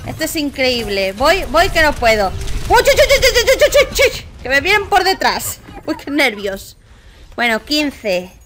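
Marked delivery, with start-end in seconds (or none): 2.7-4.2 high, loud laughing chu-chu-chu; panicked laughing chirps. Real, not acted. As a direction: giggle out frantic little calls as they close in. Enemies coming from behind.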